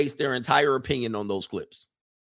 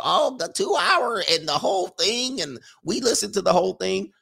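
mocking quote; 6 semitones higher than usual. Mocking people defending long streams.

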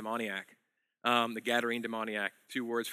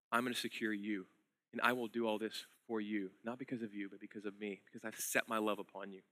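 much softer, lower, almost hushed; tender promise; 3 semitones lower, 9 dB quieter than usual. Acted. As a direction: let the love land gently and quietly. Voices God's tender promise.

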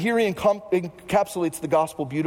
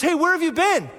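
frustrated Martha; 10 semitones higher, 4 dB louder than usual. Retold or imitated. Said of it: "she goes".

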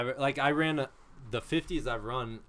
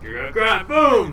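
explosive play-acted exclamation; mock excited shout; 8 semitones higher, 13 dB louder than usual. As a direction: build to a loud, high boom. Acting out a sudden grab.

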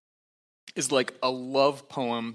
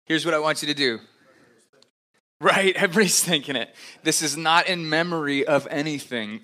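excited; 3 semitones higher, 7 dB louder than usual. Real, not acted. Laughing aside about communion.

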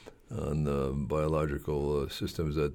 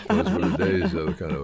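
laughing; 7 dB louder than usual. Real, not acted. Laugh heard, louder.

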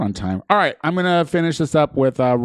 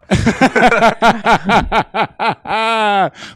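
loud, high burst of laughter; big laugh; 9 semitones higher, 5 dB louder than usual. Real, not acted. Host laughs at robot joke.

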